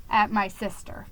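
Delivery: ordinary delivery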